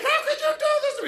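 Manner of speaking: high, fast squeaky mock wife voice. Wife of a blind man pleading in a squeaky voice. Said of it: "She's like".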